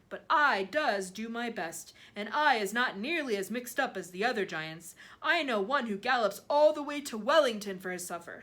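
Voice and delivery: loud, emphatic giant voice; earnest giant. A narrator's reading.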